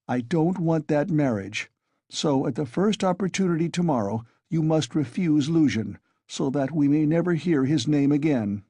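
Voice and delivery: ordinary delivery, nothing marked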